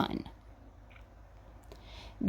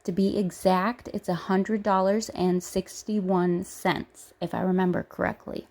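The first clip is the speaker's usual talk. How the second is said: usual speaking voice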